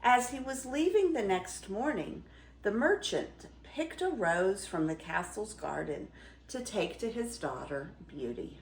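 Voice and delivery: plain reading voice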